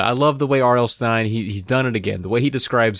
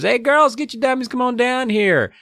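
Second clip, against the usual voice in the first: mock dad calling up the stairs; dad calling upstairs; 14 semitones higher than usual. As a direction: yell up to the girls. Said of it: "he yells upstairs".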